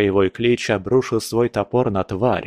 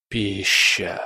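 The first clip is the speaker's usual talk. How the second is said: hissing shadow creature; 3 semitones lower than usual. A narrator's reading. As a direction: hiss it like a hungry beast. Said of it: "прошипел голос".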